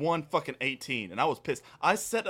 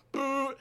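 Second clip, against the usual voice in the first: mock alarm blare; 10 semitones higher, 4 dB quieter than usual. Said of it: "and it goes".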